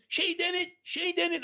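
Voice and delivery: very high mock scream of a wife. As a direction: imitate a wife calling frantically from upstairs. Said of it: "wife screaming from upstairs".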